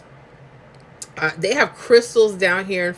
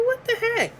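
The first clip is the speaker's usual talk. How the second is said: incredulous. Retold or imitated. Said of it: "I'm like".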